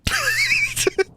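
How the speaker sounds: very high, squeaky burst of laughter. Real, not acted. Streamer cracking up at the storm, very high squeaky laugh. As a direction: crack up in disbelief. After the Thunderlord quote.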